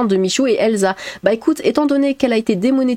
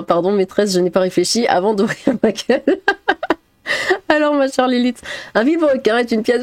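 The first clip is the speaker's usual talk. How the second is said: ordinary talk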